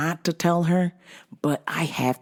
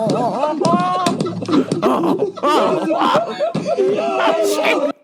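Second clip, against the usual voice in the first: laughing; 4 dB louder, much slower than usual. Retold or imitated. Building shocked reaction, laughing.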